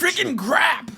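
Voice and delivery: high, prissy churchy exclamation; a high, mock-innocent cry in the pastor's school; prim mock curse. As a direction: squeak it like a goody-goody.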